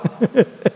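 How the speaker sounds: brief amused chuckle; a quick real laugh; short laugh. Real, not acted. Teacher laughing at the futility of resisting, short chuckle. Laugh after "How long?.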